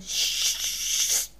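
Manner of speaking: a long unvoiced sss; drawn-out hiss of cola on fire; hissing sound effect. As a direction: mimic the fizz of a doused flame. Cola hitting the flame.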